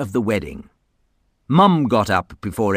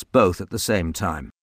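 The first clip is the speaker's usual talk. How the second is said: plain reading voice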